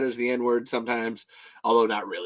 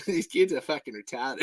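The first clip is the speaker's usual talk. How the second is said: Aussie accent breaking into laughter; Australian accent; 5 dB quieter than usual. Shown as a demonstration. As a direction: say it Australian, barely holding the laugh. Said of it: "However, the Australian accent".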